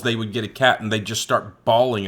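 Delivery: ordinary talk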